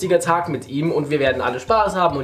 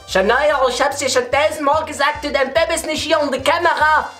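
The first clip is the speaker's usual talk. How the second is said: higher scolding mom voice; nagging mother; 9 semitones higher than usual. Retold or imitated. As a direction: nag like a fed-up mother. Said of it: "die Mutter von ihr schon die ganze Zeit gesagt".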